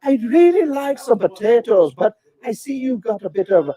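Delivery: put-on playful high Irish accent; lilting put-on Irish accent. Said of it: "forget about all the accents".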